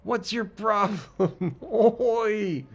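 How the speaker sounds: high, loud British heckling; British accent. Acted. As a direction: yell indignantly in a British accent. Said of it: "this game wants me to do accents".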